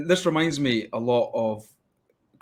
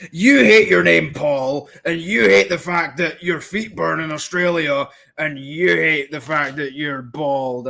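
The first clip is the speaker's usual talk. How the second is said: loud, drawn-out accusations, pitched up; hammed-up outburst of a heckling commenter; mock ranting; 4 semitones higher, 6 dB louder, slower than usual. Voices an angry online commenter's accusations.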